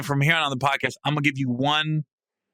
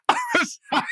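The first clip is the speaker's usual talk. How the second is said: high, laughing hard. Real, not acted. Storyteller overcome with laughter at the memory. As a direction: laugh through the words.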